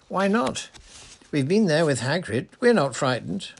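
high, loud, breathless protest. A narrator's reading. Parvati insisting to the teacher they aren't frightened, breathless. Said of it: "said Parvati breathlessly".

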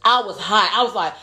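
normal talking voice

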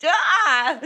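imitated yelp. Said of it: "he's like".

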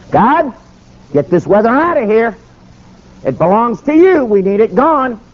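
high, fervent praying preacher. Retold or imitated. Preacher praying aloud in the snowstorm car, high fervent plea.

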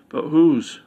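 queen startled by her own vision; alarmed question. Acted. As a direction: jolt up on the last word. Ominous prophecy rising.

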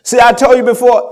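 full-force preaching. Acted. Pastor assuring the church God provides, full-force preaching. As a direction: preach it hard, calling out the church.

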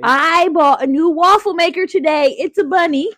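high and excited. Real, not acted. Excited waffle maker.

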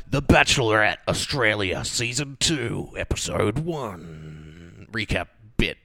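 recap bit voice. Pretend. Host introducing the episode recap in a put-on announcer voice. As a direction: put on a mock show-title announcement, drawn out. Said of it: "This is a good voice".

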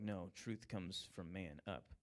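mock Aristotle pronouncing slowly and smugly; smug philosopher. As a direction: lay down man's truth with slow self-satisfaction. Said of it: "He's like".

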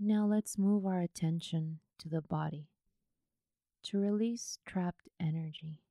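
unhurried yoga nidra guidance, leaving space after each phrase; guided meditation. Acted.

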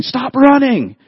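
high-pitched mock mom yell; scolding moms. Moms to a boy running through the house, imitated scolding. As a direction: call out like a mom scolding kids indoors. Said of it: "Our moms are saying".

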